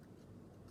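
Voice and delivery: long weary unvoiced sigh; exasperated sigh at the school; heavy sigh. Real, not acted. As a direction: let out a tired sigh. Frustrated over lost diploma.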